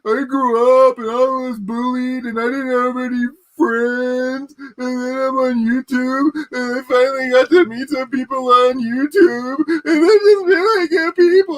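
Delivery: high, slow, mocking sob voice; mock crying whiner. Mocking a YouTuber crying about no friends, high sob voice. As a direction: blubber it out like a crybaby. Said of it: "he was, like, bitching about".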